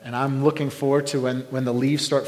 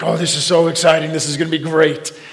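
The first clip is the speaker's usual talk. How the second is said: louder, hyped-up anticipation; acted excitement; 3 semitones higher, 6 dB louder than usual. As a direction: act out being thrilled before a game. Acts out own pre-game excitement.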